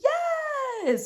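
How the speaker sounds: high squealed yes; excited squeal. Retold or imitated. Imitating Demi's yes as a high squeal. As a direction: squeal it happily. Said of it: "she says".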